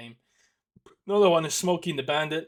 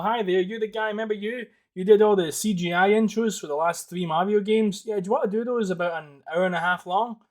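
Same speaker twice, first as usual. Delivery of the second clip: boss on the phone; 5 semitones higher, 8 dB louder than usual. Pretend. Imagined Nintendo boss phone call.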